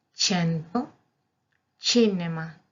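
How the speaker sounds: "cento" and "cinema" start with ch; Italian soft C. Shown as a demonstration. Teacher giving examples of C before E and I, clear demo. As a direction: say "cento, cinema" as chento, cheenema. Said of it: "se pronuncia como chi delante de la E".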